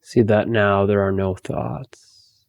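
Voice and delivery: slow, spacious meditation voice; guided meditation. Acted. Teacher guiding listeners to empty their thoughts, guided meditation. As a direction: lead them gently inward, with long pauses.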